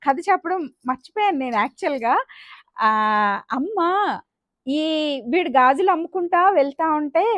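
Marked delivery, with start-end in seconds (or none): none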